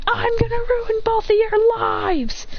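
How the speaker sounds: high, drawn-out acted cry; vengeful threat. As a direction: call the threat out high and stretched. Acted threat while falling.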